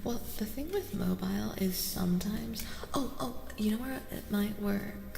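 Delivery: soft unvoiced whisper to the mic; ASMR whisper. Streamer whispering to chat about mobile, ASMR whisper. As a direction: breathe the words close to the mic.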